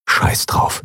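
unvoiced inner curse; whispered thought. Sephiron thinking a curse, whispered. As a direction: whisper it to yourself. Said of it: "dachte er".

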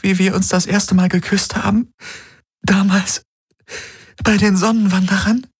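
high, rising, desperate plea; pleading young woman. Acted. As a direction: beg him to remember. Kirai keeps pleading.

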